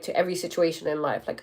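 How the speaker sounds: ordinary delivery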